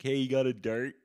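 a hint of a pushy bar guy; guy bumming a smoke. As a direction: call out a casual request to a stranger. Acts out guy asking for smoke.

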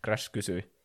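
usual speaking voice

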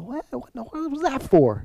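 high-pitched puzzled reader; mock bafflement. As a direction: squeak out your confusion, playfully. Acting out a baffled Bible reader.

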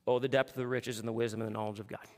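flat, fast, lifeless reading of "Oh, the depth of the riches"; bored mock recital of scripture; dull reading. Shown as a demonstration. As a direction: rattle it off without feeling. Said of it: "some of you read it like this".

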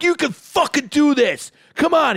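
high, stretched-out gym exhortation; pumped-up hype yell. Retold or imitated. Chris urging Strennan to lift, high hype yell. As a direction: push him on in a high holler. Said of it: "getting pumped up".

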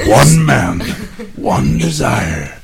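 slow dramatic trailer narrator voice; movie trailer voice. Acted. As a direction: drag it out like a film trailer. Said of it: "not your movie trailer voice".

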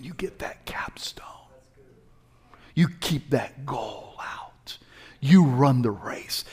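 intense whisper. Real, not acted. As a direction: whisper the promise close. Whispered preaching.

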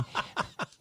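a breathy little laugh; soft laugh. Real, not acted. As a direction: laugh under your breath. Laughs at own story.

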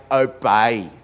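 "Obey" drawn out, oh... BAY; slow, deliberate "Obey" with a long final vowel; over-clear repetition. Retold or imitated. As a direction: spell out "Obey" syllable by syllable for a machine. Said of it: "said it again a bit more clearly".

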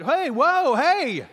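loud, high-pitched shout after someone; mock protesting call. Child calls after players taking the ball, loud mock protest. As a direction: call out in comic indignation.

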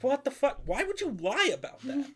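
high-pitched exasperated disbelief; mock outrage. Comedian grilling a woman who lied about her color, mock outrage. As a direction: act baffled at a pointless lie. Retells comedian challenging audience member's lie.

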